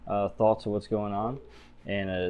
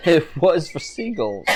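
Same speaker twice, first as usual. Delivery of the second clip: laughing; 6 semitones higher, 4 dB louder than usual. Real, not acted. Laughing through the line.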